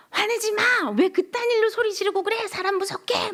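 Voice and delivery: high, strained mock scolding; mock angry yell. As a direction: snap back as if offended. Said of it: "막 이런단 말이에요". (높고 날카로운 흉내 호통)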